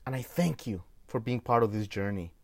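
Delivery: ordinary talk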